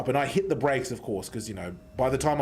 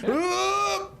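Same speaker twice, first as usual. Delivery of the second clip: high, shouted "ooh" of impact; very high startled yelp; crash yelp. Retold or imitated. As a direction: yelp as the car hits. Moment of the crash.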